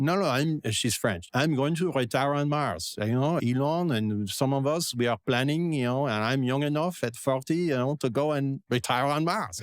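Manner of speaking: imitating a French entrepreneur; French accent. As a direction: quote her in a French accent. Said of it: "That was a really good accent".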